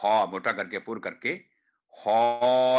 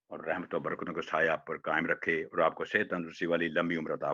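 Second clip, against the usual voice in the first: closing prayer; 5 semitones lower than usual. Acted. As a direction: bless the students gently.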